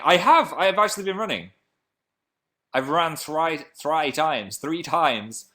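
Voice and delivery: high, loud, eager answer; excited announcement. Real, not acted. Streamer answering a viewer about running, excited. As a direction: exclaim it with surprise at yourself.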